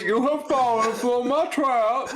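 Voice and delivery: high, slow, laughing villain line; laughing mock villain reveal; villain gloat. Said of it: "It's like".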